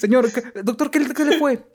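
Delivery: voz aguda y ansiosa; familiar angustiado. Pretend. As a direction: pregunta con angustia al doctor. Familiar pregunta al doctor. (familiar ansioso pregunta al doctor)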